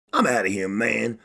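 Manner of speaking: ordinary talk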